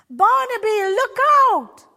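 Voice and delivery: loud, high warning shout. A narrator's reading. Shepherd shouts to warn straying sheep, loud urgent shout. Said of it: "shouted the shepherd".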